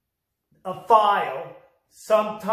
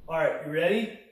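usual speaking voice

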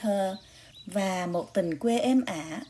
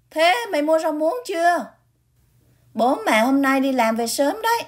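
higher, louder nagging sister; bossy older sister; 8 semitones higher, 7 dB louder than usual. Acted. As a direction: nag him from across the yard, higher.

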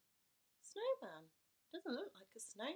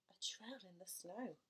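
hushed excited whisper; 5 dB quieter than usual. Acted. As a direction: breathe it out secretly. Whispered discovery.

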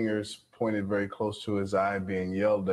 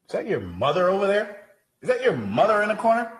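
alarmed pointing; 8 semitones higher, 3 dB louder than usual. Shown as a demonstration. Coach tries to startle student.

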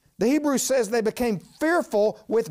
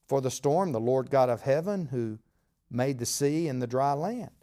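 usual speaking voice

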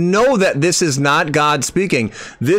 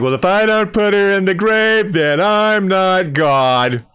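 mocking parody; 6 semitones higher than usual. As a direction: ham up her claim to ridicule it. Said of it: "It's like".

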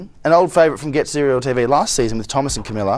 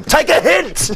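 teasing shout; 12 semitones higher, slower than usual. Real, not acted. Teasing contestant about clues.

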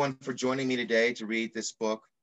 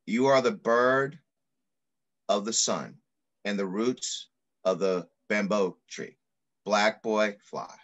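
put-on reading to children. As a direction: read it like an affirming poem to children. Reads picture book aloud.

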